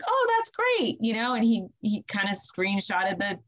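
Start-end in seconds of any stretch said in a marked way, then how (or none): 0.1-1.0 high, breezy "Oh, that's great"; breezy mock cheer. Midwife answering husband's labor text, breezy mock cheer. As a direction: play it cool and bright, no worry. Casual reply to anxious husband.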